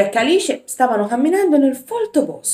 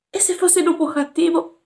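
put-on higher, fearful child's voice; scared little boy; 9 semitones higher than usual. Jack asks Alice, scared of the wolf, higher fearful voice. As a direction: squeak it out like a frightened boy.

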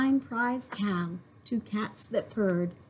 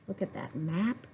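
ordinary delivery, nothing marked

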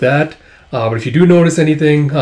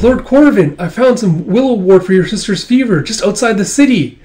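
high, animated little helper; eager Kippa voice; 8 semitones higher, 4 dB louder than usual. Acted. Kippa bringing news to Lord Corvin, eager and high. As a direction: pitch it up and report eagerly.

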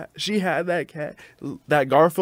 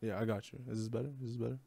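speaking softly on request; quiet; 3 semitones lower, 18 dB quieter, much faster than usual. Real, not acted. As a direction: keep it low and soft. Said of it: "Talk a little quieter".

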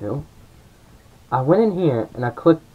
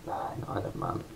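whispered; 5 semitones lower, 15 dB quieter than usual. Real, not acted. Trails off to a sigh.

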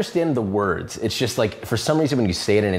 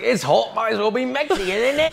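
higher, sing-song mock British lady; mock British TV cook, a little higher; imitating the British cook; 10 semitones higher than usual. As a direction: lightly mimic her offhand remark. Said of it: "She's like".

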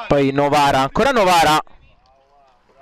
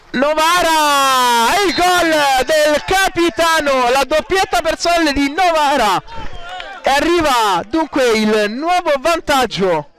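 breathless italian goal commentary; 4 semitones higher than usual. Real, not acted. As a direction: stretch out the scorer's name. Captain's goal called.

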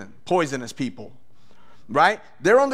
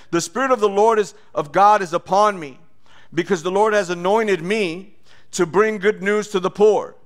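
preached scripture, louder; scripture reading; 3 semitones higher, 4 dB louder than usual. Acted. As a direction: proclaim the verse with force. Reads scripture aloud in preaching.

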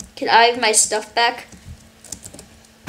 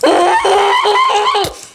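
high laugh; 9 semitones higher, 5 dB louder than usual. Real, not acted. Laughs after yelling at kid.

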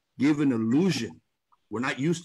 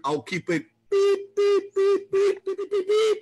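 high, loud mock censor beeps up in the air; 5 dB louder than usual. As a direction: bleep out the swearing like a TV censor. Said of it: "because we're on a family show".

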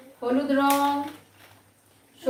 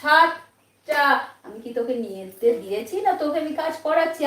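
ordinary delivery

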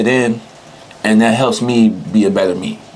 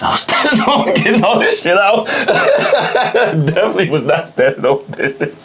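laughing; 13 semitones higher than usual. Real, not acted. Laughing at his dirty shoe.

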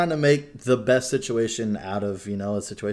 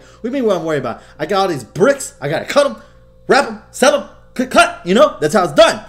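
much higher and louder ranting hustler; manic dealer act; 11 semitones higher, 13 dB louder than usual. Retold or imitated. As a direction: rant like a wired dealer. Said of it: "He's all like".

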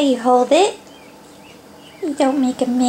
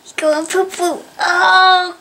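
normal talking voice

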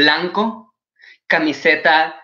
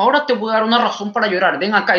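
mock parental threat; 5 semitones higher, faster than usual. Mother threatens crying child.

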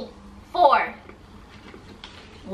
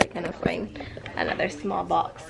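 whisper; 2 semitones lower, 8 dB quieter than usual. Real, not acted.

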